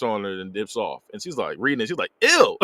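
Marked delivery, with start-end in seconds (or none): 2.2-2.6 high, loud "ew"; imitated squeal of disgust; mock disgust. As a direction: blurt it high as if grossed out. Retold disgust at love note.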